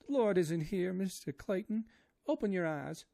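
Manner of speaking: high, loud wailing maid voice; line slipped into the maid's high wail; Esmeralda's voice. A narrator's reading. Misread in Esmeralda's voice.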